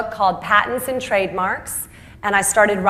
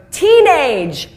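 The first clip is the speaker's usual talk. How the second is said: very slow, much higher and louder punchline; big reveal; 9 semitones higher, 8 dB louder, much slower than usual. Retold or imitated. Speaker revealing his four words, loud slow grand reveal. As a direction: roll out each word like a grand finale. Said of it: "he continued on to go".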